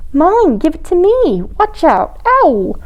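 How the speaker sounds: high, demanding little penguin voices; snatch and protest in high pitch; squabbling. A narrator's reading. Penguins fight over things, squabbling.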